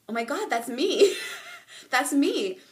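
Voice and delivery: mock startled recognition with a laugh; amused realization. Vlogger retelling her childhood thought at mass, amused realization.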